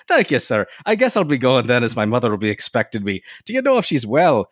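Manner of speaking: high-pitched stage-Irish lilt; caricature Irish accent. Acted. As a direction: play up an old-fashioned Irish-American brogue. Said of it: "nineteenth century caricatures of Irish Americans".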